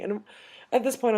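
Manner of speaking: usual speaking voice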